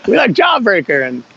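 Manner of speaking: high-pitched, hammed-up backpedalling; mock defensive insistence. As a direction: insist on it in a squeaky mock protest. Acts out hasty change of allegiance.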